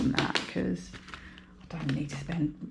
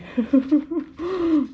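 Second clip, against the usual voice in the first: high loud burst of laughter; self-mocking laugh; a bright cackle at her own fuss; laugh at herself; 10 semitones higher, 8 dB louder than usual. Real, not acted.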